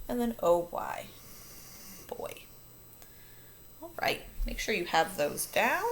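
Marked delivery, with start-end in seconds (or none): none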